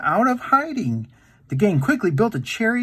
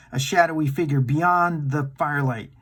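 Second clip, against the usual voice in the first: usual speaking voice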